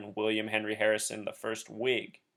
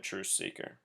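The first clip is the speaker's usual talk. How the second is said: breathy whisper of the nickname; whispered aside; 4 semitones lower, 14 dB quieter than usual. Real, not acted. Whispering to his truth seeker viewers.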